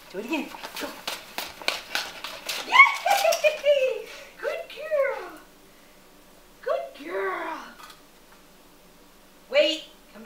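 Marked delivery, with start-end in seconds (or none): 2.7-7.6 excited praise. Real, not acted. Praising dog after weave run.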